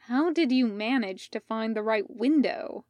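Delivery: ordinary delivery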